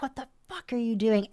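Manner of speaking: startled, angry yell, acted out; retold yell. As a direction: re-enact yelling at an intruder. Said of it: "and yell and flick the light on".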